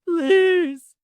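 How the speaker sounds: high, loud pleading wail. A narrator's reading. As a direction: wail it like a desperate beggar. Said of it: "the fox wailed".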